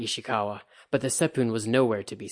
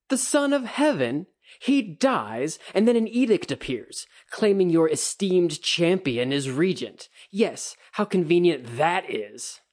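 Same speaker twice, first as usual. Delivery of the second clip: much higher, loud mocking scorn, slowing at the end; sarcastic sneer; 7 semitones higher, 4 dB louder than usual. A narrator's reading. Kikita mocking the champion's regency, loud sarcastic sneer. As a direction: drip contempt on every word.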